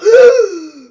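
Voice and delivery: sharp, loud, high shocked gasp; startled real gasp. Real, not acted. Sudden surprise in game.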